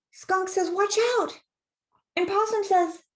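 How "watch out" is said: high, loud storybook warning; acted urgent alarm; warning shout. A narrator's reading. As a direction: shout it high to a friend in danger.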